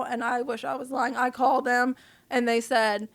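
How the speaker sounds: normal talking voice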